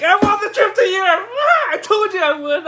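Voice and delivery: high, loud shriek of joy; ecstatic screaming. Retold or imitated. Winner telling his friend about the trip, ecstatic screaming. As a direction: scream the big news, beside yourself.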